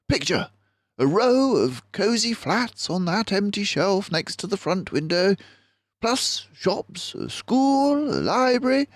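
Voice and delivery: high, eager planning voice; a mouse dreaming up a whole town; excited Basil. Acted. Basil plans a town in the cellar, high and eager.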